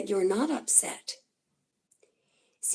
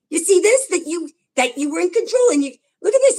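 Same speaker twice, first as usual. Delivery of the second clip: excited; 7 semitones higher, 6 dB louder than usual. Real, not acted. Excited reader points at cards.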